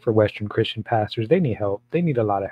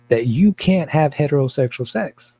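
ordinary delivery

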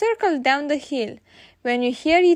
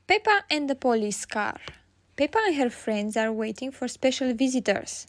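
ordinary delivery

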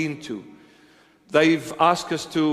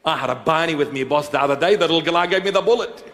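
Australian accent impression; 3 semitones higher, faster than usual. Pastor performing Aussie slang for congregation, laughing Australian accent. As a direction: lay on a broad mock Australian accent, grinning through it. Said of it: "I gave him this statement".

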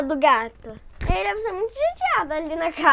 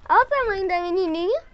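ordinary delivery

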